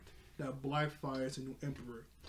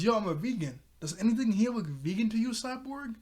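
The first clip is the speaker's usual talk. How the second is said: higher-pitched mock annoyance; kinda mad; 7 semitones higher than usual. Beast Boy the vegetarian complaining to Cyborg, mock annoyed. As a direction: imitate a miffed complaint, pitch climbing. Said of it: "he's kinda mad".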